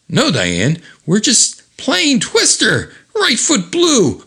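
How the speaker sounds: high, drawn-out innocent fib; comic excuse in mid-fight; strained excuse. Acted. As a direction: stretch out a high-pitched alibi. Chrissy fibs while getting punched.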